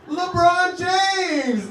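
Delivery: high, loud, drawn-out fan call; starstruck fan. Retold or imitated. Mock fan calling out a star's name. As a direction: stretch the name out like an excited fan. Fans mistaking him for stars.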